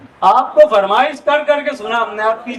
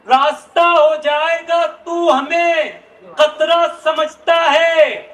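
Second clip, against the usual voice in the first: stately nazm recitation; 5 semitones higher than usual. Acted. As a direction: recite each line with weight. Verses recited, hint 58-82.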